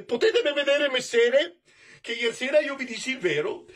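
high, eager pleading servant voice; Chichibio, frightened servant. Acted.

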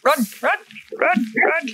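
loud, high panicked calls; urgent shouting. Real, not acted. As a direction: yell it, urging escape. Fleeing danger in game.

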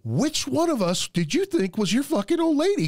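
high, heated retort; mock angry yell. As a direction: fire back at him, high and indignant.

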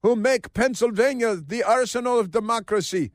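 high mock-orator with put-on accent; Josh Shapiro. Host quoting the governor's rally speech, mocking put-on accent. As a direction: declaim in a caricatured speechmaking accent. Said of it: "Why did he speak with that accent?".

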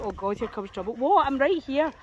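ordinary talk

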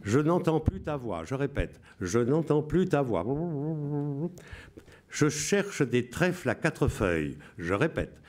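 solemn Radio Londres announcer. Shown as a demonstration. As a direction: read the coded messages like a BBC broadcaster. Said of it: "Je vous lis avec la voix".

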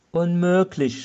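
high, loud cry of disbelief; mock-despairing shout of impossible; narrated shout. A narrator's reading. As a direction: burst out appalled. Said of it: "schrie er".

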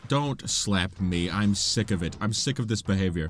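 low, deliberate annoyance; fed up, pitched well down; annoyed. Pretend. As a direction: scold the enemy in a low, fed-up voice. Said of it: "I'm annoyed with it".

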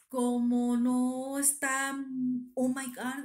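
imitando a una doctora exasperada, fuerte y muy lento; drawn-out, loud exasperated groaning words. Retold or imitated. La doctora exasperada al dar malas noticias. (exasperada, alargando las palabras)

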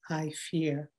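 ordinary delivery

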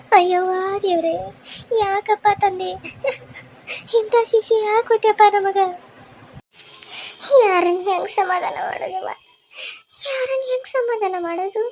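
drawn-in grief with a crying edge; tearful lament. Acted. Grandmother asking God why this punishment, lamenting. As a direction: cry out to God in despair.

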